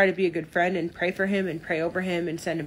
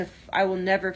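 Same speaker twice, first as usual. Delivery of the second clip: ordinary delivery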